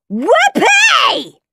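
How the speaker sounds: very high, loud whoop of joy; gleeful shout. A narrator's reading. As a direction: cheer like an overjoyed boy. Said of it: "shouted Henry".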